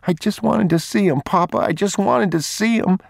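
put-on high child's voice, tearful appeal; boy pleading. Boy explaining himself to his father, high and pleading.

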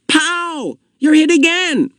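high-pitched mock gunshot shout; gleeful game yell. A narrator's reading. Boy firing imaginary gun at his friend, yelling. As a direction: yell it like a child playing war. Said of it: "Asher yelled from behind the tree".

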